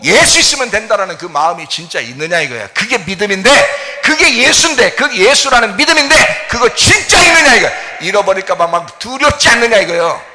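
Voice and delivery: building to a high, loud shout; preaching shout. Acted. Pastor challenging the congregation's faith, rising to a shout. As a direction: cry it out from the pulpit.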